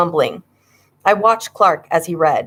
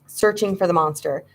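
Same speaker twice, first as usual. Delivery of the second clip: usual speaking voice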